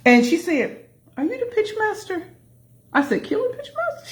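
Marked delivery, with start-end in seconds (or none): 1.2-2.2 curious woman. Retold or imitated. Woman's question retold. 3.2-4.1 surprised. Retold or imitated. Her own surprised reply retold.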